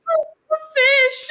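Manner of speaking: high, loud, drawn-out mock wail; retold sobbing. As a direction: wail it out, imitating your own sobbing. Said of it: "I just started sobbing".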